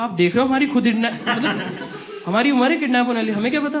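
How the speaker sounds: high, loud incredulous pleading; can't believe they're accusing us; exasperated protest. Retold or imitated. Student protests to the mother he kidnapped nobody, loud and high.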